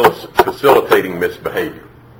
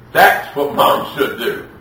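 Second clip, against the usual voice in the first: usual speaking voice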